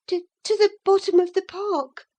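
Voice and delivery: high, fast, frightened stammer; young girl caught out, stumbling. A narrator's reading. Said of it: "she stammered".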